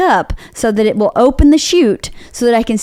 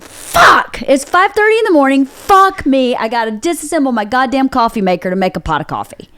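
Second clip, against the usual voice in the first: a sudden, higher yell of frustration, easing off at the end; furious outburst; 4 semitones higher than usual. Retold or imitated. At the grinder at five thirty, shouting angrily. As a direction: explode at the coffee maker at dawn. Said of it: "I'm like".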